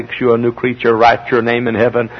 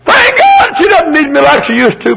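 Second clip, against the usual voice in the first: high, loud pulpit shout; fiery sermon cry; full-force preaching; 6 dB louder than usual. Real, not acted.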